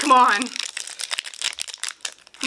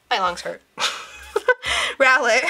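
ordinary delivery

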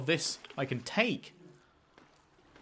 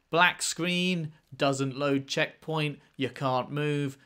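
normal talking voice

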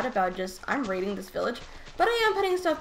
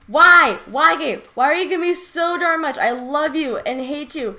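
loud, high cry of disbelief; overwhelmed yelling; 5 semitones higher, 11 dB louder than usual. Real, not acted. Player asking the game why, overwhelmed yelling. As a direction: yell in happy exasperation. Screams at the game over luck.

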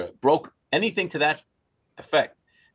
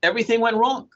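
exasperated; 11 semitones higher, 4 dB louder than usual. Retold or imitated. Exasperated recounting to the scribe.

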